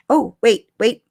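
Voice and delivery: ordinary talk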